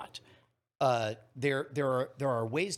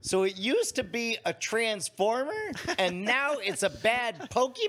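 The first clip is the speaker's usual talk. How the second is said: daughter's voice; 9 semitones higher, 4 dB louder than usual. Retold or imitated. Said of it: "She goes".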